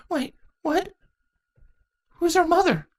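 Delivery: high, startled questions; surprised teenage changeling. Acted. Saronis asks Shining Armor in surprise, high-pitched. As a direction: jolt with surprise.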